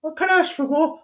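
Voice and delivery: very high, loud squeaky Cockney beggar; mock Cockney urchin. Urchin begging the governor for a free ticket, squeaky Cockney. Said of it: "I heard your Cockney accent".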